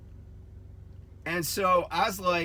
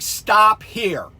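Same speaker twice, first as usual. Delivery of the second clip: loud, higher-pitched re-enacted order; firm command; 4 semitones higher, 9 dB louder than usual. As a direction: order the driver to halt. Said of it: "I said it in that tone of voice".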